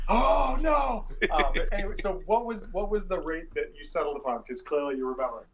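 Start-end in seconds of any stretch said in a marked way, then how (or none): 0.1-1.1 high loud exclamation; exclaiming. Real, not acted. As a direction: cry out in mock dismay. DM exclaims in mock dismay.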